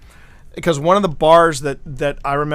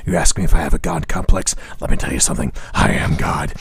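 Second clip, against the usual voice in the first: much lower, deep smug growl of a man who thinks he's God; Alec Baldwin impression; 12 semitones lower than usual. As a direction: drop way down and boast like a cigar-soaked tough guy. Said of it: "I call it the Alec Baldwin complex".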